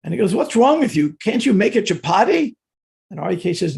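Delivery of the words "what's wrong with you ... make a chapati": higher and louder, teasing rebuke; mock scolding. Prabhupada to Hari Kesh over failed chapatis: louder mock scolding. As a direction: scold the cook half in jest.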